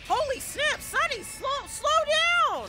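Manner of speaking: high panicked yell; panicked shout. Real, not acted. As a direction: yell in alarm as things speed up. Panicked yelling at character.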